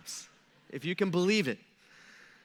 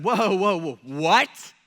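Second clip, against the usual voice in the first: loud startled whoa, whoa, what; stunned disbelief from the crowd; shocked crowd; 6 dB louder, much slower than usual. Retold or imitated. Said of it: "he shocks the crowd".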